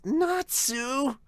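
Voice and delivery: high, sharp call of a name. Acted. Sister calls her brother's name.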